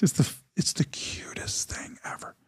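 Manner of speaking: fond whispered aside; hushed aside. Real, not acted. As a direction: drop to a whisper, confiding how sweet it is. Tender aside on the suitor.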